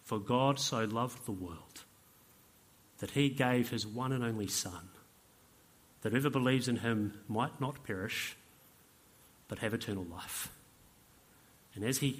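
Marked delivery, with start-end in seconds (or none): 0.0-1.8 slow, hushed scripture reading; reverent Bible reading. Acted. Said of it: "opened his Bible and he started reading". 3.0-10.5 low, soft scripture reading with long pauses; reverent Bible reading. Acted. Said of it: "opened his Bible and he started reading".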